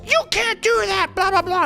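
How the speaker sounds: mock a cute character flipping out; shrill cartoon tantrum parody; cartoony freak-out. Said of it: "a character freaks out on somebody".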